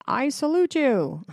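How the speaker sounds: sneering mock-formal salute. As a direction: hand it over like a mock-grand salute. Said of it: "was all like".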